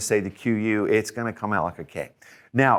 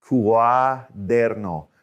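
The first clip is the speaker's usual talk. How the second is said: "cuaderno" stretched out very slowly; slow careful pronunciation; 3 dB louder, much slower than usual. Shown as a demonstration. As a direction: draw out "cuaderno" syllable by syllable for students. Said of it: "listen to the next part".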